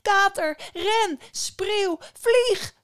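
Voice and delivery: very high, loud single-word cries; screaming at full force. A narrator's reading. Dragged-off boy calls his brothers, screaming as hard as he can. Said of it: "Zo hard als hij kon, schreeuwde hij".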